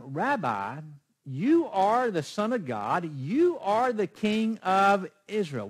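loud, high, slow declaration; declaring Nathanael. Acted. Preacher voices Nathanael declaring Jesus King, loud and slow. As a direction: proclaim it in awe as Nathanael.

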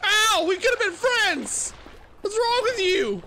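high, loud panicked yelps at a monster; frightened yelling. Real, not acted. Gamer yelling at a creature that attacks him, high and loud. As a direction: yelp and shout in real fright.

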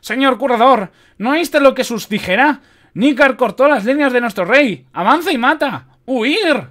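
shrill, loud panicking soldier; high, forceful cries of alarm; high-pitched shouting. A narrator's reading. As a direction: shout high and frantic for everyone to flee. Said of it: "gritó otra vez el soldado con una voz aguda".

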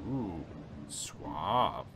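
a smooth, mock-suave drawl of the word suave. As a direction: purr suave like a charming gentleman. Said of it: "My character's now suave".

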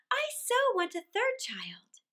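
high, light lady's voice; mother's reassurance. A narrator's reading. Mother to her left-out little boy, high and reassuring.

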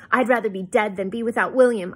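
loud, impassioned declaration; voice rising to a pitch. A narrator's reading. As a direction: let the voice climb with feeling. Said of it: "my voice rising to a pitch".

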